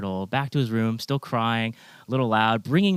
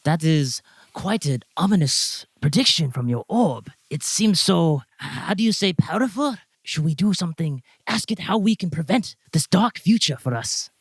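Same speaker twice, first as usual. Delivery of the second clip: higher, wide-eyed foreign-tinged voice; lieutenant commodore impressed by the orb, pitched higher; awed lieutenant; 6 semitones higher than usual. Acted. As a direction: marvel at the orb's power. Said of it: "she looks at the orb and goes".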